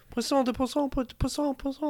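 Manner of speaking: put-on high childlike voice repeating the word; imitating a small kid. Imitating a young French kid pointing at fish, high child voice. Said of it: "one of them is like".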